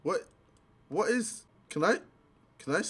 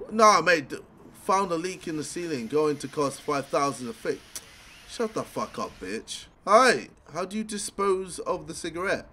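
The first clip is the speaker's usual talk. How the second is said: ordinary talk, nothing marked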